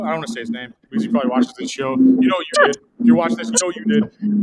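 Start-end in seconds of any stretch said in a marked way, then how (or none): 2.0-4.4 louder, higher accusing jab at the absent guard; mock-threatening callout; pointed callout. Real, not acted. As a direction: call him out, half joking. Calling out security guard on air.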